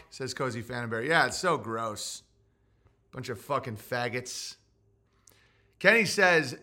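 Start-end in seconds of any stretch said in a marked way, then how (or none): none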